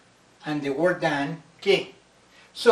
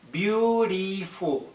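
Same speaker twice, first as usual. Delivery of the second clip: Beautiful slowed right down, three beats apart; syllables counted out; 3 semitones higher, much slower than usual. Shown as a demonstration. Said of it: "How many syllables?".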